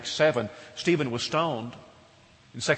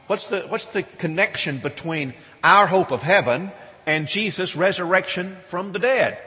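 usual speaking voice

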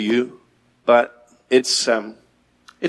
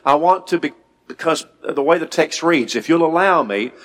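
normal talking voice

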